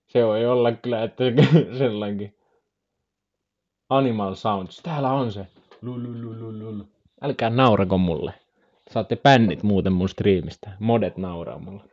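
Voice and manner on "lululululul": soft babbled lululu; imitated anteater noise. Streamer guessing what an anteater sounds like, soft lululu. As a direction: roll out a quiet tongue-flapping lulu. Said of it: "mitä ääntä se pitää".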